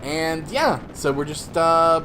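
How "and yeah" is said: ordinary delivery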